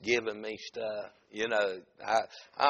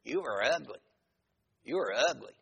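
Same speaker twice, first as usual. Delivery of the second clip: parrot voice; 3 semitones higher than usual. Acted. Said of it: "the parrot said".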